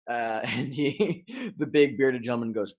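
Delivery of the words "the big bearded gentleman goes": ordinary delivery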